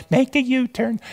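high-pitched, kindly satnav lady imitation; kindly GPS voice. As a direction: mimic a patient GPS lady. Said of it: "G P S very kindly says".

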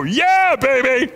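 high, loud whoop of joy; excited shout. Retold or imitated. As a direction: crow it out like you won a prize. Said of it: "I was so excited".

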